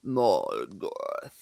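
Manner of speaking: deep, drawn-out dark lord voice; ominous villain name. Pretend. Joking about Tolkien names, a deep menacing Morgoth.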